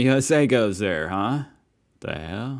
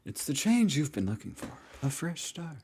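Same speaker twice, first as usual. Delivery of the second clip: high, airy cult-leader intro voice, much softer; mock cult leader; 8 semitones higher, 5 dB quieter than usual. Reacting viewer mocks the video narrator as a high, airy cult leader. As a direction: parody a soothing cult recruiter. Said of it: "sound like one of those cult leader, um, like, intro videos".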